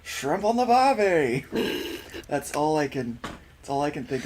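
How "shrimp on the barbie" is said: put-on over-the-top Australian accent, higher and much louder. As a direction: quote the movie line as a cartoon Aussie. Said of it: "the most stereotypical, probably offensive thing".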